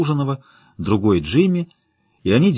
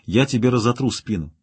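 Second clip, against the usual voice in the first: ordinary delivery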